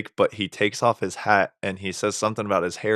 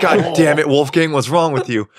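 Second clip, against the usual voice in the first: mock exasperated yell; 5 semitones higher, 6 dB louder than usual. Mock scolding grandpa.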